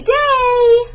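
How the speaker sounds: cheery high bye. Real, not acted. Cheerful sign-off.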